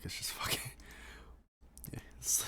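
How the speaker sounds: ordinary talk, nothing marked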